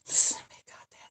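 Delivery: quick unvoiced aside; whispered aside. Real, not acted. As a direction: whisper it under your breath. Aside under breath.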